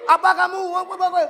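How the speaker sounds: put-on hot-headed kid snapping back; angry retort. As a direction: bark it back in a huff. Acted angry retort, not advised.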